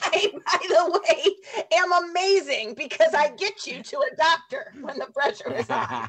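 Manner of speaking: laughing. Real, not acted. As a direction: boast through laughter. Laughing self-praise.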